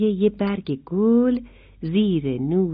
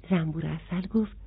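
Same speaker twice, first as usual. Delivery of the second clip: plain reading voice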